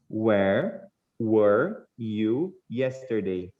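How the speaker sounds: very slow, every word set apart; walk the student through it slowly; teacher modelling the question slowly; slow model reading. Shown as a demonstration. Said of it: "dá pra ver bem a diferença das duas pronúncias".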